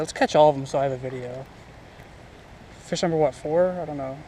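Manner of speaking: usual speaking voice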